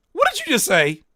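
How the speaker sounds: squealing what did you just say; shocked. Real, not acted. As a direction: demand it in stunned disbelief. Shocked by the opening line.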